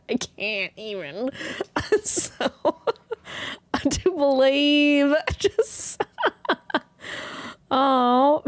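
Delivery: laughing too hard to get words out; giggling fit, words breaking up; real laughing fit, barely speaking; helpless laughter. Real, not acted. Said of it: "I'm just still laughing".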